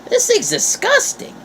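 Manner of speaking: put-on very high, squeaky little-kid voice; young grandson whining about a cherry tomato, pitched very high; whiny kid refusal. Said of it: "And I'm like".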